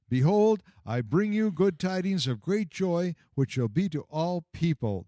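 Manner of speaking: high, loud heavenly announcement; angel's proclamation. Acted. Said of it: "the angel said".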